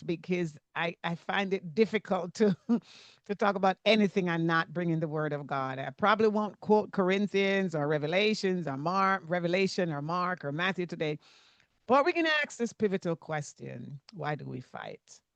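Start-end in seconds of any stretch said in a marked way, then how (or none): none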